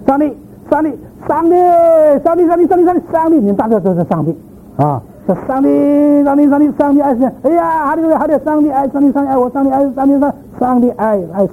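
preacher lampoons fervent shouting of hallelujah; mocking ecstatic shouting. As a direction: chant it shrill and fast, as if jumping about for an hour. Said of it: "这个一节圣经可以喊了一个小时".

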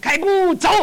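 high, sharp order; drill command. Retold or imitated. Soldier ordering the officer to march, drill call.

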